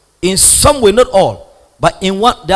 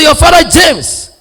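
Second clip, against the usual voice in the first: loud announcement; 13 semitones higher, 10 dB louder than usual. Retold or imitated.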